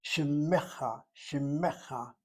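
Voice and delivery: shimecha with a long doubled m and a short e; correct pronunciation. Shown as a demonstration. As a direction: stretch the mem in shimecha. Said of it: "That's why I'm pronouncing the word".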